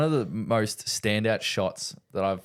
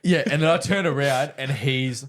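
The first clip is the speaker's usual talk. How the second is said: laughing; 7 semitones higher than usual. Real, not acted. Retells colleague losing it.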